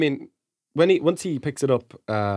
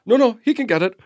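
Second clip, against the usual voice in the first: insistent dad; 10 semitones higher, 4 dB louder than usual. Retold or imitated. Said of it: "the dad was just like".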